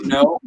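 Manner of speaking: NO drawn out high with an R on the end; imitated long NO with an R tail; dragged-out R-ended no. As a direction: stretch NO up high, closing on an R. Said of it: "there's, like, a R on the end".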